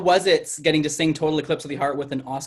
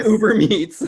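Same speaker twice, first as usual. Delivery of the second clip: laughing; 6 semitones higher, 4 dB louder, slower than usual. Real, not acted.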